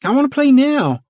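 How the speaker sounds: put-on very high, loud child's demand; demanding cry. A narrator's reading. Ruthie demands to play now, crying out high and loud. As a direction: insist loudly like a stubborn child. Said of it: "Ruthie cried".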